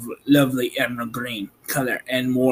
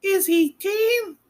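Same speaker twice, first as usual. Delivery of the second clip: high, wary farm woman. Acted. Woman at door to strange visitors, wary and high. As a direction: voice the suspicious woman higher.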